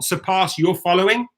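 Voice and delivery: ordinary talk, nothing marked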